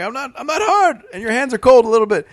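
high, loud flustered protest. Retold or imitated. Groped man protesting to two women at a bar. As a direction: protest in a high embarrassed rush.

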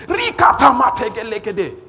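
sudden loud, high burst of tongues; shouted tongues prayer. Shown as a demonstration. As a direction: blast out a quick prayer in private. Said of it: "Just go and chop small".

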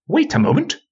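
very high, thin mouse-like voice; squeaky whisper. Acted. Little wizard interrupting the departing bench, squeaky whisper. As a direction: squeak it out like a tiny creature. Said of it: "whispered a squeaky voice".

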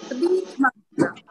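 slow, whispered, grief-stricken; grieving whisper. Real, not acted. Grieving woman tells listeners about losing Kaleli, slow sad whisper. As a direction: let the sorrow drop to a breath.

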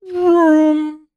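high, far-off vroom like a car engine; a goofy engine noise passed off as an accent; mock accent car noise. Silly engine vroom offered to the hosts as the Indiana accent. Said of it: "What about this accent?".

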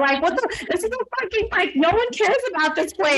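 high, exasperated retold rant with a laugh; mock outraged rant. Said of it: "I was fucking pissed".

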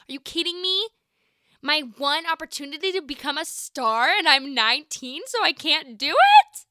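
high, loud mock despair; overly dramatic. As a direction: wail it like a soap opera. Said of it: "that's a little dramatic".

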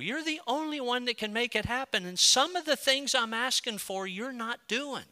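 high, insistent pleading with God; anguished protest. Acted. Believer telling God he feels let down, anguished protest. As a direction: plead your case upward, leaning hard on the complaint. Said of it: "the agony of your heart and the questions".

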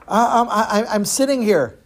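acted timid kid, tripping on words; nervous boy. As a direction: falter before a huge stranger. Frightened boy answers the giant.

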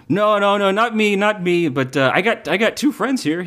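high, protesting unicorn voice. Acted. Twinkle telling the dealer the squirrels are for friends. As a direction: wave it off in a high voice. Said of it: "Twinkle goes".

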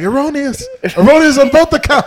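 high mock pompous Vince Vaughn voice; Vince Vaughn meme. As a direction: ham up the meme line, way up high. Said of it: "the Vince Vaughn meme where he's like".

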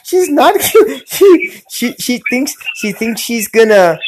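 high-pitched, helpless laughter between words; laughing. Real, not acted. Host teasing a guest who likes his accent, laughing. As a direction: stammer through a laughing fit.

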